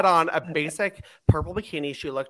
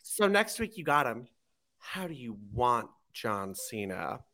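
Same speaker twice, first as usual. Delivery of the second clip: slow sultry tease; 5 semitones lower, 8 dB quieter, slower than usual. Retold or imitated. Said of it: "she's like".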